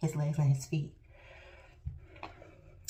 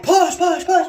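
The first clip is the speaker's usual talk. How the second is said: loud, higher push commands; urging push; 9 semitones higher, 13 dB louder, slower than usual. Retold or imitated. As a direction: urge her on loudly. Nurses urging her to push.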